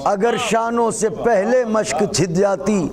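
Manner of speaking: high, declaimed verse with deliberate pauses; poetry recitation. Acted. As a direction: declaim each phrase, pitched well above chat.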